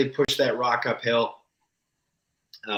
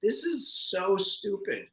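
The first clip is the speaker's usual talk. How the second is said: muttered disbelief; 6 semitones higher, 5 dB quieter, slower than usual. Retold or imitated. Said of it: "I'm like".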